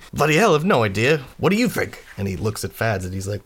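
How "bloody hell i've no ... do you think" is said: raised, agitated pitch; flustered Quint. Acted. Quint answers the expectant group, flustered protest. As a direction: throw the question back, exasperated.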